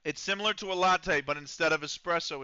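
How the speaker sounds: ordinary talk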